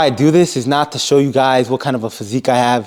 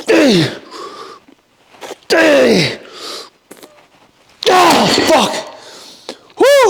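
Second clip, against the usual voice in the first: straining shouts; 12 semitones higher, much slower than usual. Real, not acted. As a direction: yell each pull out with full effort. Yelling through heavy reps.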